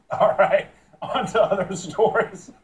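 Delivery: laughing through the handoff; fighting back laughter; anchor stifling giggles. Real, not acted. Anchor laughing after blooper.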